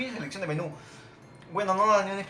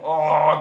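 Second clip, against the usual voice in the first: long, loud exclamation; loud startled "oh"; 14 dB louder than usual. Real, not acted. Player seeing the ball miss, loud cry. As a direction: let out a big dismayed oh.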